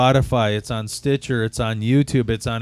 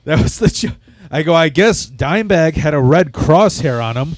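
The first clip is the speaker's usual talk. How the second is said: loud; 4 semitones higher, 8 dB louder than usual. Retold or imitated. Retelling his stage joke loud.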